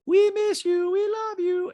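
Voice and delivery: put-on very high-pitched accented caricature; squeaky falsetto mimicry of a reality star; Diana impression. Host mimics reality star in recap, high-pitched caricature. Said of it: "And Diana's like".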